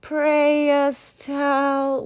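slow, flustered stalling for time; stammering. Acted. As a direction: drag it out, hunting for words. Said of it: "Walter stammered".

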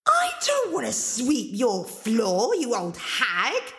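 high start, slow petulant whine; bratty refusal. Acted. Angelina refusing to sweep the ogress's floor, petulant. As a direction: draw out the words rudely.

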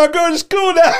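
put-on high, loud kid's call through laughter; mock schoolkid shouting to mom while laughing. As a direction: yell it like a kid heading out, cracking up. Kid leaving for school bus.

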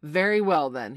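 loud, bold announcement; crying out. A narrator's reading. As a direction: cry it out boldly. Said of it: "he cried".

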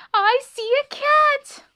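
very high, loud, drawn-out squeal; terrified mouse squeal; panicked squeak. A narrator's reading. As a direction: shriek at spotting a predator. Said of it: "squeaked Annie suddenly".

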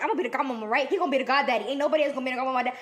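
rapid-fire excited chatter; reenacted fast babbling; talking a mile a minute. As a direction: rattle it off without breathing. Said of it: "talking a miles per hour".

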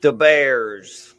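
put-on loud, punched Chicago sports-fan accent. Showing off a Chicago accent to a friend, loud Chicago accent. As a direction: belt it out in a thick Chicago accent. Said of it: "but I know a Chicago accent".

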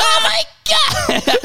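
very high, slow, drawn-out wail; mock shower shriek. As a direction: squeal it under icy water. Mocking his shower cries.

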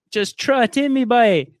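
put-on loud, high, thick Newfie accent. Retelling the Newfie wife's line in a loud Newfie accent. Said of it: "that's what they sound like".

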